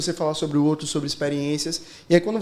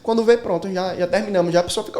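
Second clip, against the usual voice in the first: ordinary delivery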